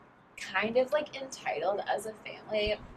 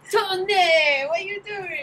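much higher voice through laughter; laughing impression of a brother; 12 semitones higher, 10 dB louder, slower than usual. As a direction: call out to him, giggling. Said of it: "Anthony is like".

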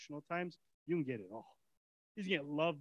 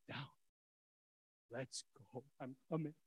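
soft eager reply; 5 semitones lower, 7 dB quieter than usual. Acted. Heart's eager quiet reply.

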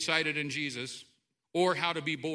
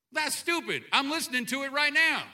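annoyed retort; 7 semitones higher than usual. Retold or imitated.

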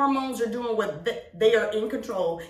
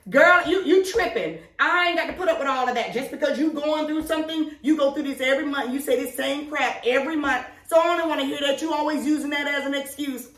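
put-on dismissive boyfriend; 6 semitones higher than usual. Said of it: "say something like".

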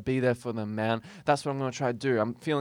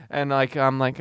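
usual speaking voice

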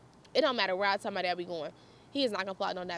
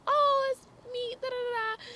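bubbly voice; 13 semitones higher than usual. Retold or imitated. Friendly kid voiced cheerily.

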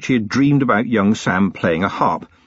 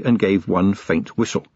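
usual speaking voice

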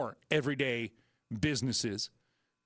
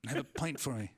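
bad British accent; 3 dB quieter than usual. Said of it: "I can't do the British accent".

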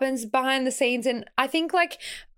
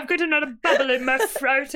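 higher, hammed-up British accent; mock UK accent; 4 semitones higher than usual. Joking about coming back from the UK, mock UK accent. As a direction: put on a silly UK accent through laughter. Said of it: "like, a U K accent".

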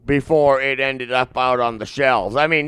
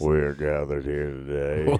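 much lower, soft, solemn preacher bass; deep wedding officiant; 11 semitones lower, 8 dB quieter than usual. Pretend. Friend asks for a wedding intro, answered in solemn deep bass. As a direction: open a wedding in your lowest solemn voice. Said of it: "give me the intro to the wedding".

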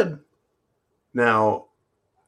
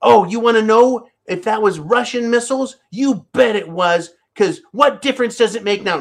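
high, put-on politician voice; hammed-up Hillary, pitched up; Hillary Clinton impression; 8 semitones higher, 3 dB louder than usual. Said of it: "Hillary Clinton's voice".

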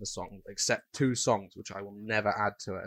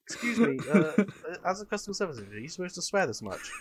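higher, polite offended customer; primly offended caller; 7 semitones higher, faster than usual. Pretend. Customer complaining to agent.

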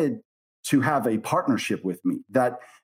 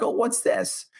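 surprised; 9 semitones higher than usual. Retold or imitated. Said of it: "And I'm like".

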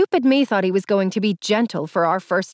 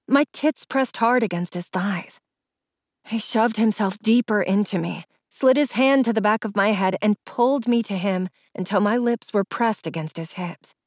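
ordinary delivery

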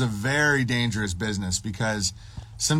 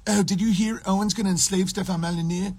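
higher, put-on tattling voice; mock scandalized gossip; 6 semitones higher than usual. Viewer pretending he was serious, mocked high voice. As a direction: spread the news in a mocking high voice.

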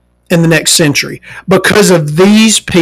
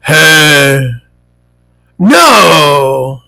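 dopey cashier voice; 5 semitones lower than usual. Retold or imitated.

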